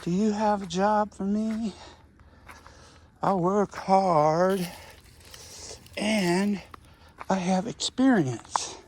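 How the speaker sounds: put-on earnest kid selling himself, each phrase deliberate; imitating Rodney. Boy asking the gardener for work, earnest and slow. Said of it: "That's how he talked".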